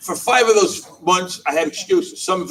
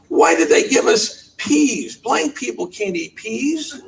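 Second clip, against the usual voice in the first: mock complaint; 6 semitones higher, much slower than usual. Woman complaining about peas.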